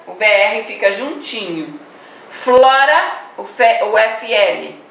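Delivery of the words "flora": "Flora" said louder and higher, FL held together; demo word, stressed. Shown as a demonstration. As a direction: announce "Flora" as an example word. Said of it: "Ó".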